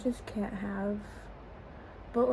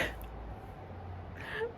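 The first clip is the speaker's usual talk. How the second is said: teary laugh; 13 semitones higher, 12 dB quieter than usual. Real, not acted. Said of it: "it gets to me".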